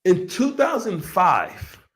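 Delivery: usual speaking voice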